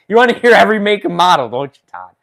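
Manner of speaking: loud, high, rapid tease; playful taunting. Pretend. Streamer teases Todd about every make and model, playful taunting. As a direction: needle them playfully at full voice.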